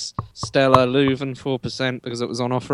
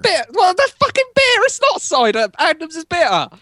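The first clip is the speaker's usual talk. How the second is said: high, loud indignant outburst; 4 dB louder than usual. Real, not acted. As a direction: defend the beer in outrage. Indignant defence of his beer.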